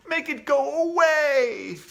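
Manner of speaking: high, slow panicked plea; frightened boy pleading. Acted. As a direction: beg in terror. Boy panicking after scream.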